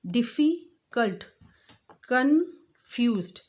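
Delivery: डिफ़िकल्ट and कन्फ्यूज्ड said slowly, broken into parts; slow syllable-by-syllable demo; syllabled words. Shown as a demonstration. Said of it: "जिसको हम एक ही सांस में नहीं".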